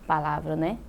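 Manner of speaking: ordinary talk, nothing marked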